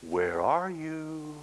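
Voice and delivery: high, slow, far-carrying shepherd's call. Acted. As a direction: call gently for a lost sheep, drawn out. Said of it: "that voice was saying".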